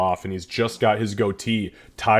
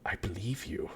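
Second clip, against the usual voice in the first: hushed dwarf; 17 dB quieter than usual. Acted. Old dwarf close, nose to nose.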